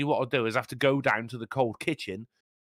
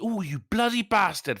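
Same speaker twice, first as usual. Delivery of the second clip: mock outrage; 7 semitones higher than usual. Real, not acted.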